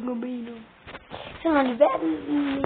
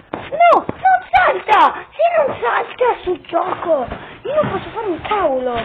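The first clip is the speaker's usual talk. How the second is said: frustrated yelling, high and loud; explosive italian cursing; 8 semitones higher, 9 dB louder than usual. Real, not acted. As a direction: yell at the broken game. Game not working, frustrated yelling. (urla esasperate contro il gioco)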